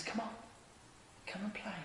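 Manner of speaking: very soft, tender invitation; gentle coaxing. Acted. Jesus calling hesitant onlookers to play, hushed and gentle. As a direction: coax softly, barely above a murmur.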